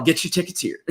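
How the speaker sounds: quick, lightly voiced auctioneer pitch; a touch of an auctioneer hawking tickets. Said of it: "auctioneer voice".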